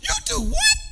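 very high, disbelieving squeal; astonished. Acted. Preacher gasps at Zacchaeus' vow in astonishment. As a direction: act stunned by the promise.

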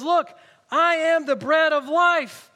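proclaiming Jesus. Acted. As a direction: announce it slowly to the whole crowd. Preacher voices Jesus to crowd.

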